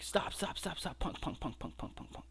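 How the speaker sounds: breathy half-whisper, fading to almost nothing; mock whispered pleading that trails away; urgent hushed plea. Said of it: "'Cause Samoa Joe's like".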